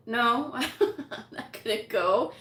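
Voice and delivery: slow, laughing through the words; laughing refusal. Retold or imitated. Daughter refusing her mom, laughing.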